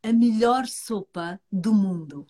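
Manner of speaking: ordinary delivery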